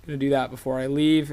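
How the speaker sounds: normal talking voice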